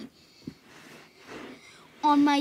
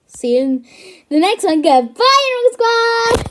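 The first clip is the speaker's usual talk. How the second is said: loud, higher hyped sign-off; shouting the channel outro; 7 semitones higher, 13 dB louder than usual. Acted. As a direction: bellow the catchphrase. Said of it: "What the frick was that?".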